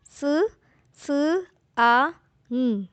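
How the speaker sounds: स, स, आ, ng sounded out one by one with pauses; sounding out letters. Shown as a demonstration. Teacher spelling a word aloud for learners, sound by sound. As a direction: split सांग into its separate sounds. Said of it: "जैसे".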